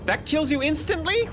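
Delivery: high, loud incredulous question; can't believe the game just did that; shocked disbelief. Real, not acted. Gamer in disbelief at instant death, shocked.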